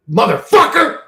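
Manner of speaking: sudden loud, high yell; shouted curse. Real, not acted. Cursing God to chat, a sudden high shout.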